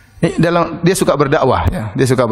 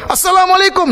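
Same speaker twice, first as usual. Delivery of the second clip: loud greeting shout. Retold or imitated. Preacher retelling the sheikh greeting the idol, shouting. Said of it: "Dua kali".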